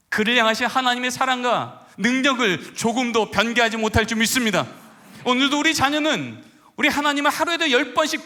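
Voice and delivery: loud, driving sermon cadence; full-force preaching. Acted. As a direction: preach it out, building to each 믿습니다.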